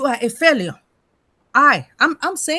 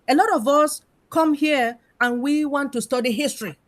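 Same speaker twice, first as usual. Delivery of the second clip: ordinary talk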